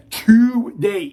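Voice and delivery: usual speaking voice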